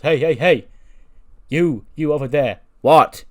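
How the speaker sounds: shouting hey across the backstage; calling out loudly. Retold or imitated. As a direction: yell across the room to get his attention. Truth calling out to someone.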